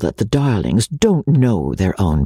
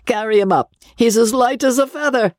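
high-pitched mock concern with laughter; hammed-up pity from a jeering old gentleman; pitiless teasing; 11 semitones higher than usual. A narrator's reading. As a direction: make a merciless show of fussing over him. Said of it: "continued the pitiless old gentleman".

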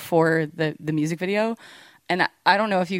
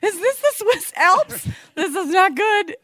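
high, slow, worried; laughing through baffled worry; 13 semitones higher than usual. Retold or imitated. Quoting drummer confused.

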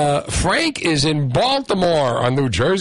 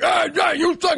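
high jeering hey, you suck; mock taunt. Fan heckling rival fans in a high taunting voice. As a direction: razz the other fans with a high jeer.

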